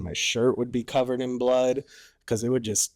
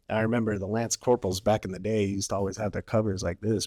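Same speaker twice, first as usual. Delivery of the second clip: normal talking voice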